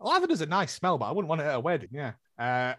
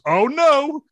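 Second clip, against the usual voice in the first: higher, louder mock "oh no"; mock dismay; 9 semitones higher, 6 dB louder than usual. Friend retelling the groom's reaction, mock dismay. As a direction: ham up his sudden alarm. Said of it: "he literally goes".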